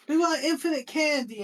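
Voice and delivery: put-on high squeaky child voice; kids' voice. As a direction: pipe it up like an eager kid. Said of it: "they're like".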